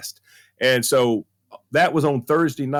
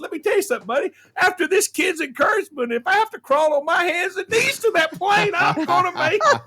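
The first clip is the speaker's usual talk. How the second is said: high-pitched, emphatic comeback; mock determination; 13 semitones higher than usual. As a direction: retell it as a high, fired-up vow. Acted determined retort to man at counter.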